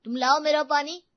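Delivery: loud, pushy order; loud demand. Acted. Spoiled son demands water from his mother, loud.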